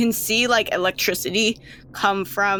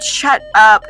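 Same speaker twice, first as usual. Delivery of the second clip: loud, irritated captor; exasperated snap; 11 dB louder than usual. Acted. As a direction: cut the pleading child off loudly. Captor silences pleading child.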